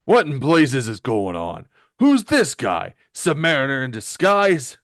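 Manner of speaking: loud, indignant character voice; Ben. Acted. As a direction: bellow it in blustery outrage. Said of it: "and he says".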